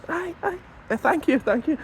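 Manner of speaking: high, soft, sheepish thanks; meek homeless guy. Acted. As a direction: squeak out a guilty thank you.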